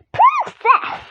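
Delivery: high, odd voice for the guard. Acted. Guard addresses the princess.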